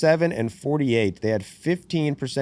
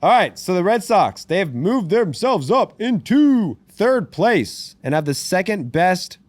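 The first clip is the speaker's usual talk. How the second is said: loud, higher hyped sports announcer; 6 semitones higher, 4 dB louder than usual. Acted. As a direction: sell the comeback like a broadcaster. Hyped announcer stats read.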